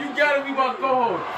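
high, laughing call to keep going; excited laughing shout. Real, not acted. To his new co-host, a thrilled laughing shout.

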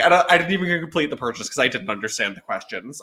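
ordinary talk

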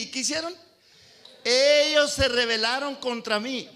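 loud, high, slowed scripture proclamation; full-force preaching. Acted. Preacher declaring God's words to the congregation, loud and slow. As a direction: thunder the verses out to the church.

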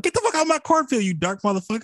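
hammed-up yell at a stranger in the cornfield; mock yell. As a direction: chase him off, fast and high. Farmer yelling at intruder.

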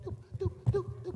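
high, steady checkout beep; high-pitched electronic blips by mouth; imitated scanner beeps. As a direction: mimic a register scanner, "doop, doop". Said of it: "those new beeper checkouts".

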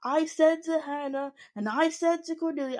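a hint of an indignant cast member on repeat; mocking Tanisha. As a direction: parody her saying it over and over. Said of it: "I think she said, like, fifteen times".

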